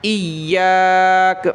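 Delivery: ایاک with the final sound stopped at a pause, slow; pausal form. Shown as a demonstration. Teacher showing how to stop on the word, pausal ایاک. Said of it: "کس طرح رکیں گے؟".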